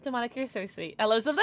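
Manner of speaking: ordinary talk, nothing marked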